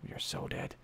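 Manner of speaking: whispered, hushed and low; whispered dread. Real, not acted. Gamer whispering that his squad is finished. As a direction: breathe it out in a hush.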